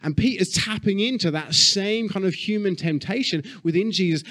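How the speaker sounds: ordinary talk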